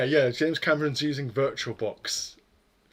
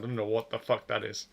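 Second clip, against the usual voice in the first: normal talking voice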